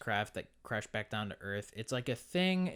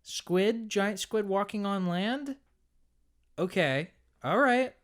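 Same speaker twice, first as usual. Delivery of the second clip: high, louder mocking disbelief; incredulous; 10 semitones higher, 6 dB louder, slower than usual. Real, not acted. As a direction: scoff at it in disbelief. Mocking the movie's giant squid.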